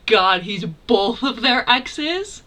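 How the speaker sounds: real loud, drawn-out astonishment; genuinely flabbergasted. Real, not acted. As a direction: stretch the words out, floored and loud. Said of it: "how genuinely flabbergasted I am".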